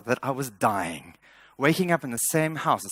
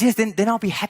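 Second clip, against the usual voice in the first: much higher, louder mock wife; pitched-up imitation of a demanding wife; wife's voice; 8 semitones higher, 6 dB louder, faster than usual. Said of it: "And she says".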